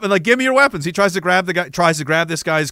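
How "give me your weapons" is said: higher-pitched urgent order; imitated soldier's demand. Acting out soldier demanding weapons.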